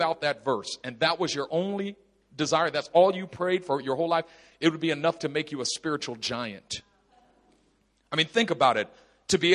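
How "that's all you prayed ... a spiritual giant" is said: ordinary talk, nothing marked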